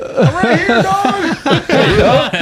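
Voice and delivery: very high hammed-up call back; comic castaway. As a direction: answer the search in a silly high cry. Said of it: "it's me in a little box, butt naked".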